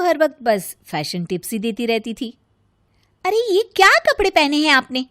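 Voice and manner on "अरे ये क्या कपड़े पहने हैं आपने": bossy scolding. Acted. Rita scolds family on clothes.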